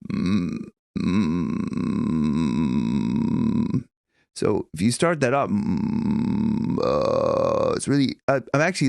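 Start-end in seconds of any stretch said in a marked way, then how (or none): 0.1-3.8 low yawny hum. Shown as a demonstration. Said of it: "taking a yawn position".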